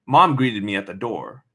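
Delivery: ordinary delivery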